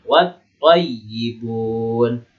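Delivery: "Wat thayyibun" with a held stop into th; doubled th. Shown as a demonstration. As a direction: recite "Wat thayyibun" slowly, no l, stressed double consonant. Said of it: "Tasdidnya sini".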